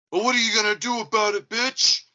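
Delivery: high, loud mocking taunt; taunting challenge. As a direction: taunt him, daring a fight. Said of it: "the Amazon driver at this time says".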